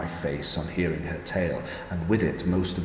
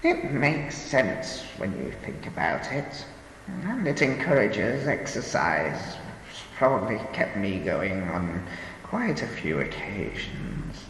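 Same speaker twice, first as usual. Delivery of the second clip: put-on slow, higher old woman voice; 4 semitones higher than usual. A narrator's reading. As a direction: speak as a frail old lady.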